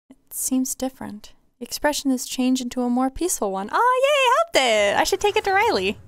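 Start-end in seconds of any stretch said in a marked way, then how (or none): 3.7-6.0 thrilled it worked, high happy yelp; delighted cheer. Real, not acted. Delighted after healing the creature.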